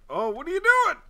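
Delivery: high, loud cry of disbelief; real outraged shout; exasperated yell. Real, not acted. Yelling at game character.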